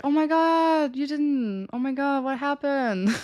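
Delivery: lightly hammed-up dismay at a friend's breakup; half-hearted concern. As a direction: react with put-on, not quite caring surprise. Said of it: "I sounded a lot more like I cared".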